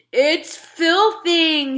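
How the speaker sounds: high, slow sputter through a mouthful; sputtering mouth full. A narrator's reading. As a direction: spit the word out round food. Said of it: "he sputtered".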